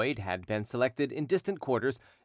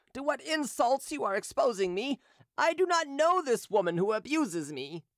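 higher, injured protest; indignant Derues; 12 semitones higher than usual. Acted. Derues denying the hawker to his creditor, raised injured voice. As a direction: protest your innocence, wounded.

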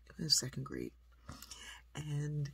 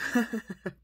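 imitated scoffing chuckle, loud and higher; mock laugh at boastful parents; doctor's dismissive laugh; 7 semitones higher, 7 dB louder than usual.

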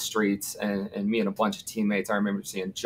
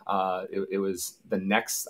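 ordinary delivery

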